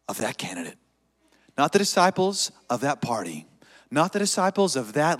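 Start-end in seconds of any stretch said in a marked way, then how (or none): none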